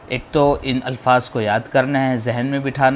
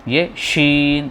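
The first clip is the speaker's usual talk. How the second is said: شین stretched very slowly; drawn out; 3 semitones higher, much slower than usual. Shown as a demonstration. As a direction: drag out شین. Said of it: "تھوڑا کھینچ کے".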